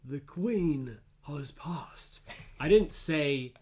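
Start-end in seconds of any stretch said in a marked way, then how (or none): 0.0-2.2 "The Queen has passed" drawn out slowly in a mock British accent; mock posh British repeat; posh British impression. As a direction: echo the radio in a slow posh British voice. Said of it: "You just said it like that".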